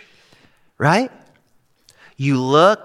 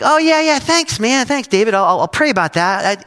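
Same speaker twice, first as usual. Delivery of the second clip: overeager brush-off; 7 semitones higher, 4 dB louder than usual. Retold or imitated. Mock-eager reply to friend David.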